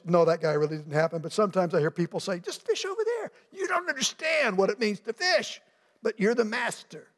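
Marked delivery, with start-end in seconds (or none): 2.4-7.1 far higher, mock-squeaky know-it-all voice; mocking busybody. People telling the fisherman where to fish, squeaky mock know-it-all. People giving unwanted advice.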